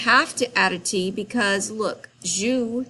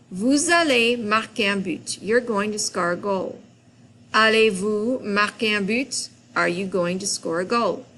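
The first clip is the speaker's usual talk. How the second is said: ordinary talk, nothing marked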